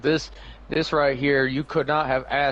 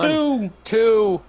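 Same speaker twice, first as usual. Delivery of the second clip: excited count; 8 semitones higher, 4 dB louder than usual. Acted. Co-host counting along the pin.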